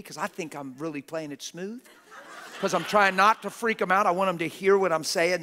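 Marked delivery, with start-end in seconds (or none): 2.6-5.4 loud, high, comic protest; worked-up shout. Real, not acted. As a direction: insist on calm at full volume. Jokingly claims calm while shouting.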